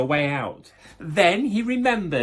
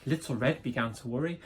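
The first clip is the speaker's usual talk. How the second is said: ordinary delivery, nothing marked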